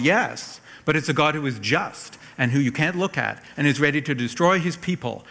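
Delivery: usual speaking voice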